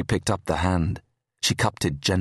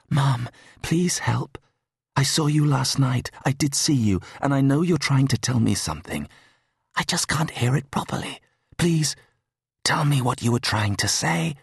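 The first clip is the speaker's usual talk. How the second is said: a touch of tearful pleading, higher; holding back tears; 7 semitones higher than usual. Acted. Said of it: "She fought the tears".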